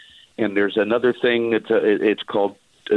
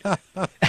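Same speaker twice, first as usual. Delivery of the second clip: short laugh; 8 semitones higher, 3 dB quieter than usual. Real, not acted. Laughs at his own story.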